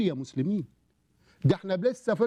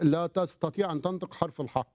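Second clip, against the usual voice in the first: ordinary talk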